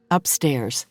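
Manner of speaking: usual speaking voice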